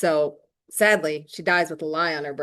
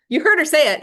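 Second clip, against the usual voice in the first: high, loud scornful repeat; mocking outrage; 10 semitones higher, 8 dB louder, faster than usual. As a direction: throw his words back with scorn. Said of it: "What a scumbag, right?".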